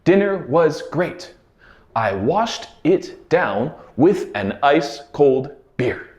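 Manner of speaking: slow, careful "Dinner was great"; slow clear model. Shown as a demonstration. Teacher slowly modelling the dinner sentence. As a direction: read it slowly for learners to follow. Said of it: "This phrase was".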